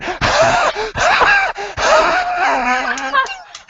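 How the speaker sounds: shrieking high fake laughter; over-the-top laugh. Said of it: "I want you going".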